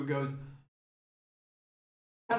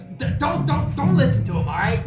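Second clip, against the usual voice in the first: panicked pleading; 4 semitones higher, 5 dB louder than usual. Acted. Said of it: "Stormcatcher, goes".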